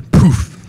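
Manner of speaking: loud puff of a magic pop; loud magic poof. Retold or imitated. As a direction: pop the sound out sharply. Genie appears from lamp.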